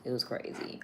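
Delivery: soft whisper to herself. Real, not acted. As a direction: breathe it out as an afterthought. Whispered aside after sighs.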